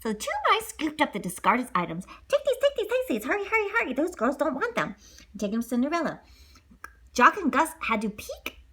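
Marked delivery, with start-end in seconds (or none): 2.3-4.9 high hurried mouse. Acted. Mice grabbing items.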